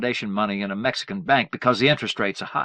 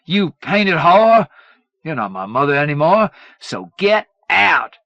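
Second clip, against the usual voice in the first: furious shout; 7 semitones higher, 9 dB louder than usual. A narrator's reading. Son raging at his mother, shouting. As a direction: scream her out of the house.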